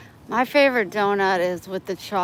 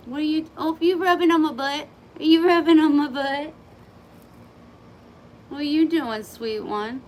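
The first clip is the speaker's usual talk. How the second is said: high, sing-song pet voice; 7 semitones higher than usual. Real, not acted. A cat rubbing against her legs, high playful baby talk. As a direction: tease the cat sweetly as it rubs on you.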